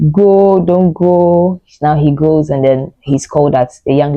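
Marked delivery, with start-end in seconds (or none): 0.1-1.6 put-on pleading girl. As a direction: beg him slowly to stay and leave. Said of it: "It was like".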